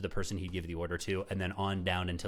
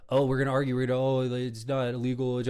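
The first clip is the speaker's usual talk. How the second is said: mocking quote; 3 semitones higher, 3 dB louder than usual. Host mimicking opponents arguing legality, mockingly. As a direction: parody their flimsy legal argument.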